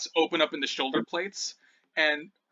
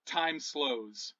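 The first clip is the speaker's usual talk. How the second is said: ordinary delivery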